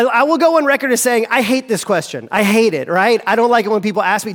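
ordinary delivery

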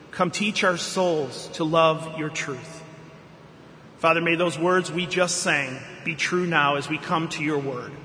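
hushed, slowing prayer voice. Acted. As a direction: pray it reverently, easing down. Pastor prays before sermon.

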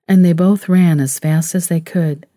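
usual speaking voice

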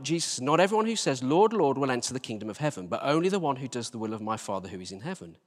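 ordinary talk, nothing marked

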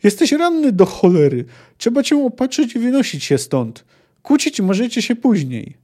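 high, urgent scolding; shouted. A narrator's reading. Jaskier urges the wounded witcher to leave, shouted. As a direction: raise the pitch and urge them on. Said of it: "krzyknął Jaskier".

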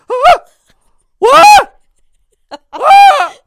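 very high, loud mock shrieks; hammed-up panicked screams; imitating freak-out. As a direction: mimic frantic screeching in short bursts. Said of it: "She's freaking out".

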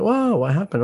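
genuinely taken aback; surprised. Retold or imitated. Psychiatrist hearing patient quit medication, high amazed wow.

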